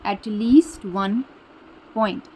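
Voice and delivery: At least one point dragged out word by word, very slow; teacher drilling At least one point slowly; slow emphasis. Shown as a demonstration. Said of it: "teacher slows to stress phrase".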